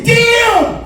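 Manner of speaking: high startled "Damn!" with a laugh; shocked yell. Retold or imitated. Comic recalling his date pulling her wig off, shocked. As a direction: blurt it out in shock.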